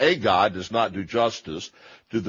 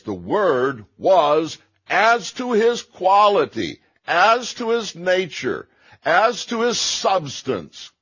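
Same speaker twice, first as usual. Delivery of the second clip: slow, higher, hammering each phrase of "as to his quality"; emphatic reading; 10 semitones higher, 5 dB louder, slower than usual. Shown as a demonstration. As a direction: drive home "as to his" phrase by phrase, slow and high. Argues his reading with heavy emphasis.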